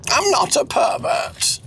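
high, slow protest; drawn-out, high-pitched denial; Cecil, protesting. Acted. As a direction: deny it in a high character voice. Plays Cecil.

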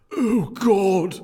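very high, very loud yelp; over-the-top cry. Acted. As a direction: blurt it in a hammed-up scream. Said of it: "I don't think it's supposed to be read like that".

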